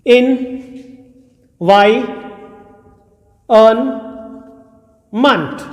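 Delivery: Environment drawn out syllable by syllable; slow teacher's demo of environment. Shown as a demonstration. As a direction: stretch environment very slowly. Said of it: "देखिए कैसे होगा".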